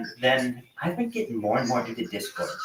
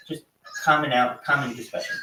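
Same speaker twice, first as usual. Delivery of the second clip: normal talking voice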